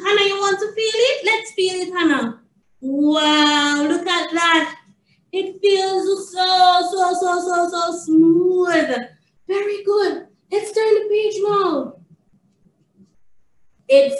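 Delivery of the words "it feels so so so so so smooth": "so" repeated and stretched, slow and lifted; slow, gushing emphasis on "so smooth"; drawn-out emphasis. Real, not acted. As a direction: draw out every "so" and stretch "smooth". Playfully stressing how smooth it feels.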